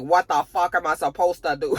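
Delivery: hammed-up bad Russian accent; comic, clumsy Russian accent; horrible Russian accent. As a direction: do a badly faked Russian, cracking up by the end. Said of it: "That was a horrible Russian accent".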